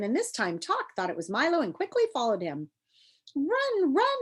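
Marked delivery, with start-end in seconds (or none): 3.3-4.2 much higher urgent call; urging call. Acted. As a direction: urge them on in a high voice. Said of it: "it urged once more".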